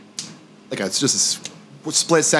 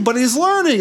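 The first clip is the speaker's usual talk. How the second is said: pleading spirit voice; 9 semitones higher than usual. Retold or imitated. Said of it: "the other vibration's like".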